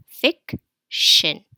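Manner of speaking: "Fiction" broken loudly into fic and shin; split into syllables. Shown as a demonstration. Teacher pointing out the final syllable, split apart. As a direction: clear pause inside "Fiction", both halves firm. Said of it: "The final syllable here is shin".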